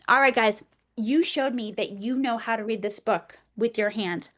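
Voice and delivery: normal talking voice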